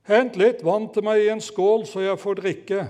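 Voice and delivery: loud, high demand for water; shouted order. Acted. Elia calling to the widow for water, loud and high. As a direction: call out the demand. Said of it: "Han ropte til henne".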